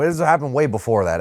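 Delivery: normal talking voice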